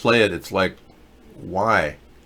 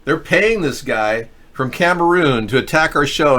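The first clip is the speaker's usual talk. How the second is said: usual speaking voice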